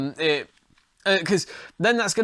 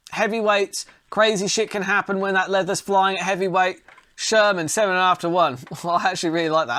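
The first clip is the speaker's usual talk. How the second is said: ordinary talk, nothing marked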